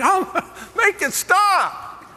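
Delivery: very high, slow, drawn-out begging; whiny plea. Retold or imitated. Sleepless kid begging the hamster wheel to stop, high and drawn out. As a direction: stretch it out pleading for the noise to end.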